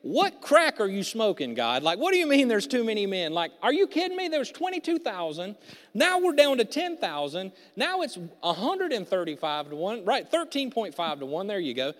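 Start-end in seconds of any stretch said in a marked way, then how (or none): 0.1-10.0 louder, higher disbelief; exasperated outburst. Retold or imitated. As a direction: yell at God in mock exasperation. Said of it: "Like, I would say".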